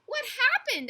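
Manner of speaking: high, very loud startled question; alarmed shout. A narrator's reading. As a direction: cry it out in storybook alarm. Startled worker in the commotion.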